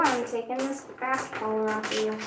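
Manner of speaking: normal talking voice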